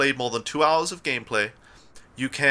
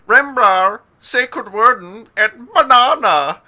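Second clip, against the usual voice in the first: loud, slow mock Swedish; fake Swedish; 9 semitones higher, 10 dB louder, much slower than usual. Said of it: "it sounds sort of like fake Swedish".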